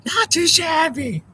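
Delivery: high, drawn-out catchphrase cry; catchphrase performance. Acted. Streamer doing his Not Too Shabby bit, high drawn-out cry. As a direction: belt out the catchphrase, high and slow. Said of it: "I should do a Not Too Shabby".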